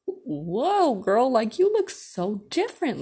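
high, exaggerated "whoa, girl" amazement; astonished reaction. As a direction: act out your shock at her makeover, voice soaring. Acting out her astonished inner reaction.

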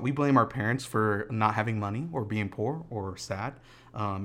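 ordinary delivery

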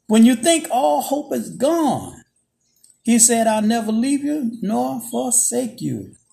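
slow, rising preacher's promise; fervent preaching. Acted. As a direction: preach it slowly, lifting the room. Preaching builds.

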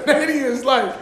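ordinary delivery

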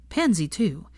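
usual speaking voice

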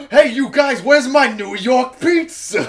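loud, high New York goof voice, laughing through it; mock Raph New York voice. Friend mocking Captain America with Raph's Brooklyn accent, loud and laughing. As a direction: ham up a pizza-loving New Yorker. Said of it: "sounding like Raph".

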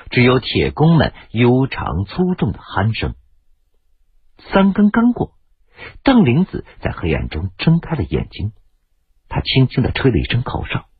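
plain reading voice

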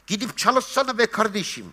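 çok daha tiz, azarlayan bir sesle; alaycı taklit. Retold or imitated. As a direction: dilenciyi paylayan adamı tiz sesle taklit et. Cimri adamı taklit ediyor. (cimri adamı taklit ederek tiz ve azarlayıcı)

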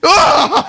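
high, loud laughing yelp; startled yell. Retold or imitated. Pumper yelling back at the owner, loud laughing yelp. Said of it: "I went".

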